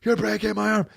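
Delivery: high, strained mock scream of pain; imitated scream. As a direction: yell it like your arm is being twisted. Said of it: "the guy was screaming out".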